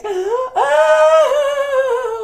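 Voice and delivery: long, high, loud bawl; mock wail. Bug girl breaks down over her boss, loud mock wail. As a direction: burst into a sustained cartoon wail.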